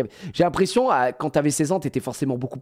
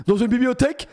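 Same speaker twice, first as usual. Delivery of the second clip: mock echo; 10 semitones higher than usual. Echoes the student's reply mockingly.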